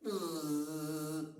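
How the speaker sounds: soft buzzing fly noise; quiet fly buzz. Acted. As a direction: buzz like a fly near an ear. Fly buzzes again.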